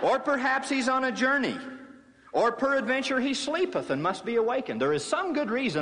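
high, sing-song mock concern; sarcastic taunt. Elijah mocking the priests of Baal, sarcastic sing-song. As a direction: pretend to explain away Baal's silence. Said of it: "Elijah mocked them".

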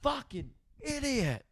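very high, held-back mock yell; retold yell. What mum heard over the phone, high soft mock yell. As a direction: squeal it slowly at reduced volume. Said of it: "And I yell".

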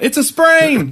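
high, loud panicked cry; mock shout. Horse pleading with its owner, loud panicked shout. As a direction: yell it like a desperate horse.

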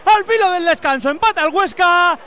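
high, loud goal commentary; breakneck goal commentary. Real, not acted. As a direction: call the goal recap at full pitch. Live goal commentary after goal.

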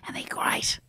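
breathy whispered aside. Shown as a demonstration. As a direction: drop to a hushed whisper on they're great. Repeats they're great twice.

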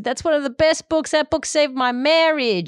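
put-on high, gushing American accent; hammed-up American tourist accent, pitched high; bad American accent. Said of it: "Sorry for the bad American accent".

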